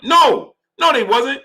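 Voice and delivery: high, loud no no; emphatic denial. Real, not acted. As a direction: shut the claim down hard. Rebutting the quoted claim.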